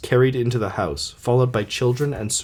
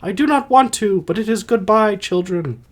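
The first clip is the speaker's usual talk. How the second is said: high put-on dying-mother voice; Alcestis voice; 10 semitones higher than usual. Dying mother taking leave of her children, high put-on voice. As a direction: say farewell way up high. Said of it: "I'll try to do voices".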